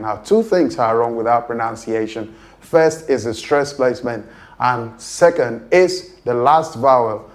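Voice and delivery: ordinary talk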